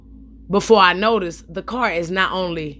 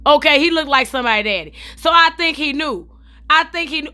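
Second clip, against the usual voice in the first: usual speaking voice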